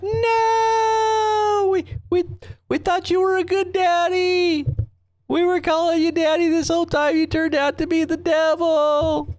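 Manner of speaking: hammed-up sobbing betrayal; crying followers. The followers wail at their father in a high, hammed-up crying voice. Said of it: "And they're like".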